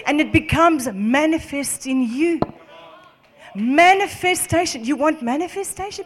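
loud, high, insistent preaching. Real, not acted. Preacher urging listeners to agree with God, full-force preaching.

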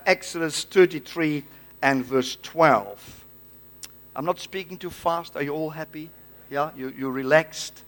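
ordinary talk

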